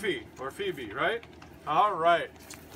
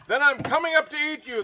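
higher, louder storybook threat; troll shout; 6 semitones higher, 5 dB louder, faster than usual. A narrator's reading. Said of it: "the troll shouted".

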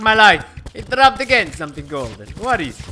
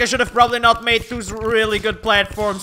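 usual speaking voice

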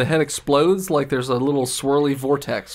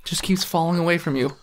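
usual speaking voice

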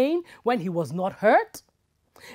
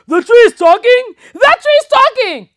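shrill, much higher panicked scream; terrified yell; 13 semitones higher, 18 dB louder than usual. Acted. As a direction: scream in fright and flee. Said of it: "yelled the hunter".

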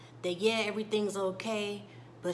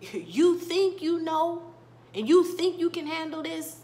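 frustrated scolding tone; 8 semitones higher, 6 dB louder than usual. Retold or imitated. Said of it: "giving her that tone".

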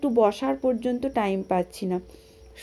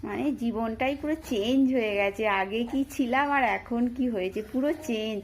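ordinary talk, nothing marked

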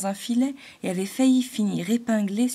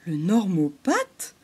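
slow, puzzled repeat of the strange word; a woodpecker savoring an odd word; slower than usual. A narrator's reading. Couspeau the woodpecker repeats it, slow and puzzled. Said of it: "répéta Couspeau le pic noir".

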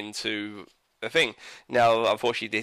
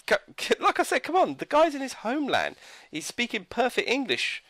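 much higher, pleading disbelief; exasperated rant; 12 semitones higher than usual. Real, not acted. Reviewer complaining to viewers, exasperated and much higher. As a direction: come on, throw your hands up at it. Reviewer ranting about missing accent.

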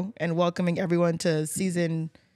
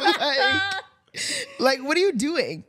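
higher, laughing question; laughing disbelief; 8 semitones higher than usual. Real, not acted. Host laughing at the caller's noisy background. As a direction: crack up while asking.

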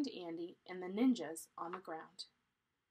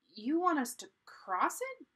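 high, loud, astonished question; shouted; 8 semitones higher, 7 dB louder than usual. A narrator's reading. Annie faces the ninjas at the stream, shouting. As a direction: shout it in disbelief. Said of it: "shouted Annie".

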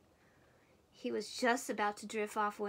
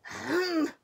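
loud, pointed "ahem"; reminding throat-clearing; 7 semitones higher, 9 dB louder than usual. A narrator's reading. Bear objects to Mouse's noise, pointed throat-clearing. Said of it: "Bear cleared his throat in a reminding sort of way".

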